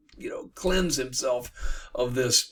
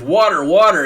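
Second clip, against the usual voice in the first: loud, raised echo of a desperate cry; echoed call for water; 6 semitones higher, 13 dB louder than usual. Retold or imitated. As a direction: repeat the cry loudly, a step less frantic. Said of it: "he calls out for".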